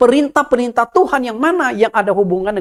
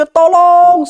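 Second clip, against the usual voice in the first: much louder, high desperate yell; louder shout for help; 10 semitones higher, 9 dB louder than usual. Acted. Young man screaming louder for anyone listening, loud desperate yell. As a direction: yell it harder so someone hears. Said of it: "dia teriak lebih kencang lagi".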